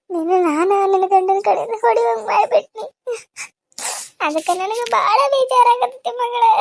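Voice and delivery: tearful, broken lament; crying in distress. Acted. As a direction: sob through the words as a grieving mother. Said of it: "ನೀವ್ ಅಳೋದ್ ನೋಡಿ".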